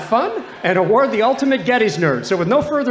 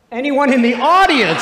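calling out; 6 semitones higher, 7 dB louder than usual. Real, not acted. Calling out to the audience.

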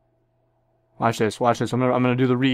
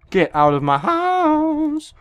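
slow, much higher put-on yell; hammed-up yell; 13 semitones higher, much slower than usual. Gamer shouting at an enemy, high and drawn out. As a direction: stretch every word like a cartoon.

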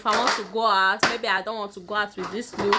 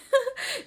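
short laugh. Real, not acted. Laughs mid-sentence about heat.

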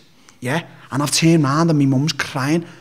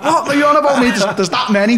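much higher, louder disbelieving retort; incredulous; 10 semitones higher, 6 dB louder than usual. Retold or imitated. Mocking a silly question, incredulous and loud. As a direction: scoff at the absurd idea.

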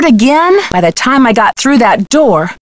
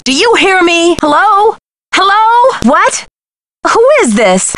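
anxious hello; 8 semitones higher than usual. Acted. Kidnapper hangs up on phone.

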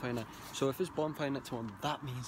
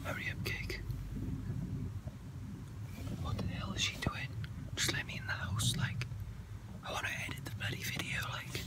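annoyed whisper, no voice. Real, not acted. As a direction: whisper your frustration at the door. Locked out waiting outside house.

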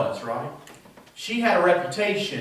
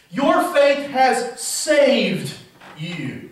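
slow repeated; 4 semitones higher, much slower than usual. Shown as a demonstration. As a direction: repeat it slowly and let it fade. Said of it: "what it says in the literal Greek".

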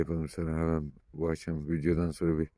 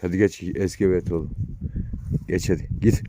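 louder, sending the dog away; raised command to a dog; firm command to the dog; 2 semitones higher, 6 dB louder than usual. Real, not acted. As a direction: order the dog home.

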